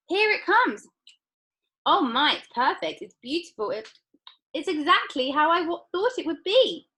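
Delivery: very high, loud rapture; thrilled girl. A narrator's reading. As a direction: swoon with delight. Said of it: "shouted Violet".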